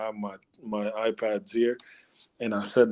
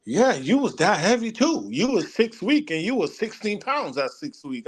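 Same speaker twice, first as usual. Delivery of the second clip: higher, imitating his mom; lightly voiced mother; mom's voice; 7 semitones higher than usual. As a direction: quote mom teasing about the weight. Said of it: "she go".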